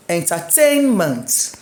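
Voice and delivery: "Entertainment" slowly, TAIN punched and stretched; stress on tain. Shown as a demonstration. Teacher shows stress with ment added, "Entertainment" stressed on tain. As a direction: hit tain in "Entertainment", let ment drop. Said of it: "My stress on tain".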